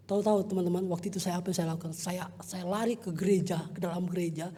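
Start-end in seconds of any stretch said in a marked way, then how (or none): none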